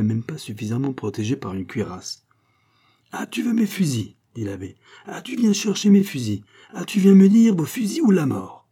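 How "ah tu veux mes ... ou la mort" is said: high, growing louder each line; mounting fury. Acted. Abbé cornering Pitou, rising high angry shouts. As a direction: press the accusation harder.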